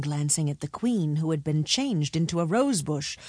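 plain reading voice

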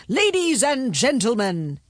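lightly voiced king addressing a crowd; proclaiming king. A narrator's reading. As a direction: raise pitch slightly for a royal address.

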